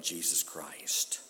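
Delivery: slow reverent whisper; whispered awe before the shout; hushed. Acted. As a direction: drop to a whisper on the name. Hushed reverent drop before climax.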